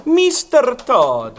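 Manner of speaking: slow menace. Acted. Pirelli drops his act, drawn out.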